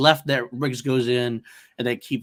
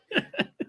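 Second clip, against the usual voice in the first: squealing laugh, very high; high-pitched cackle; 3 dB quieter than usual. Real, not acted. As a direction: burst into a shriek of laughter. Big laugh after quote.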